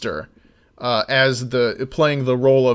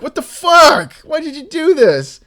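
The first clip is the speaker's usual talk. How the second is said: much higher, louder disgusted outburst; outraged disbelief; 13 semitones higher, 7 dB louder than usual. Retold or imitated. Reacting to tuna dumped in ice cream, outraged. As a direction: cry out as if tuna hit your ice cream. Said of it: "and you're like".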